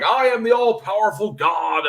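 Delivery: hammed-up booming God. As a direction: announce yourself as an all-powerful deity. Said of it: "and God is like".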